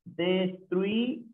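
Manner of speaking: "destruido" as des... trui... do; slow, separated syllables in "destruido". Shown as a demonstration. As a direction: sound out "destruido" slowly with pauses. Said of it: "Sería de la siguiente manera".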